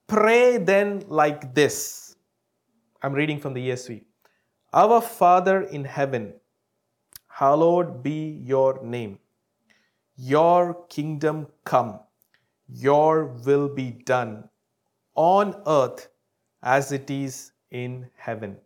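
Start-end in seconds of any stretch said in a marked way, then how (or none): 0.1-2.1 high, loud, slow solemn reading; scripture reading. Acted. Preacher to congregation, solemn slow scripture proclamation. 4.7-18.6 scripture reading. Acted. Preacher to congregation, solemn slow scripture proclamation. Reads Lord's Prayer.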